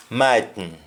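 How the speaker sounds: "mightn't" cut down to "might'n", no final t; Jamaican "mightn't". Shown as a demonstration. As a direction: swallow the end of "mightn't" into a syllabic n. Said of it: "we simply say".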